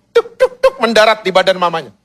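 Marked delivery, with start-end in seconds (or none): 0.1-0.7 very high, staccato taps; three little high-pitched pops; imitated landing taps. Chicks landing one by one on mother's body, imitated high taps.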